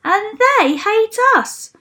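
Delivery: high, loud, stretched-out complaint; indignant protest. A narrator's reading. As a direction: chime in loudly from the crowd, word by word. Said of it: "added a third".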